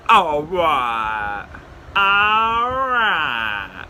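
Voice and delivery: long drawn-out, dragging reply; grudging agreement. Retold or imitated. Cops giving in to his deal, reluctant drawl. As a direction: drag each word out like sulky cops.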